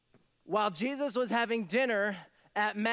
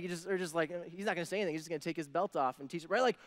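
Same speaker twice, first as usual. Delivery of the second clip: usual speaking voice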